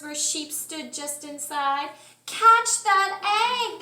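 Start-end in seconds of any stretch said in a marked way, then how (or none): 2.3-3.8 loud, slow call; Cow crying out. A narrator's reading. Cow calls out after the rolling egg, loud and slow. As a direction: shout it slowly across the barn. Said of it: "the cow cried out".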